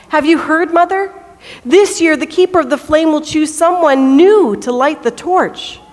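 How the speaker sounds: lightly acted breathless-with-news excitement; excited boy. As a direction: burst in with big news for mother.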